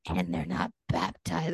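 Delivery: deadpan low mock-solemn line; joking low voice. Teasing her husband with a low, slow mock-solemn line. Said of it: "That's what I said jokingly".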